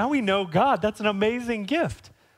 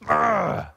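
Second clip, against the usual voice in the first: a mock exasperated ugh, a bit louder; disgusted groan; 4 semitones higher, 4 dB louder than usual. Preacher mimicking our disgust at sinners, mock groan. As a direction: groan it like you're fed up with them. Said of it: "should our posture be like".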